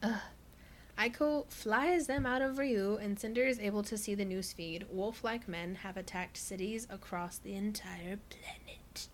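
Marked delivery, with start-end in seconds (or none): 4.9-9.1 slow whisper of doom; ominous whisper. Acted. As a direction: whisper it slowly, full of dread. Ominous news reveal.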